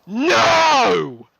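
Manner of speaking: long, high shouted protest; outraged cry. Real, not acted. Fan rejecting her favourite's death, outraged cry. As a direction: wail it loudly in utter refusal. Outraged rant over the show.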